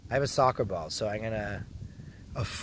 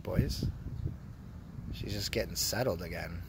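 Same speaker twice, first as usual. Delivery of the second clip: usual speaking voice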